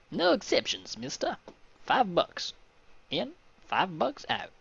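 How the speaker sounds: high-pitched put-on gangster voice; hammed-up high shakedown voice. Gangster demands money from the traveler, high and put-on.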